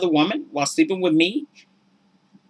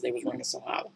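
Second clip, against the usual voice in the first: much softer, low, hollow echo; hollow hush; 6 semitones lower, 14 dB quieter, much faster than usual. Real, not acted. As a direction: let it sound empty. Echoing the hollow words.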